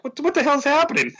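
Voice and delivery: stunned at the chaos unfolding; mock disbelief; astonished disbelief. Retold own disbelief.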